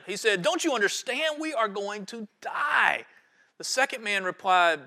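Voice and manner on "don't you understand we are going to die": high-pitched exasperated cry, last words stretched out; yelling and screaming. Acted. First castaway to the unconcerned second man, acted high-pitched yelling. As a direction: act a frantic man pleading to be understood. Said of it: "he started yelling and screaming at him".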